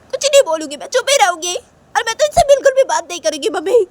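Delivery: high-pitched, aggrieved whining; acted childish sulky outburst; indignant protest. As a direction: protest loudly to your mother, hurt and cross. Uma protests to mother, acted quarrel.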